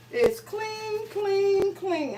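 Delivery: high, soft singsong; playful singsong. Real, not acted. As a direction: lilt it lightly, high and quiet. Playful singsong repeat while checking skillet.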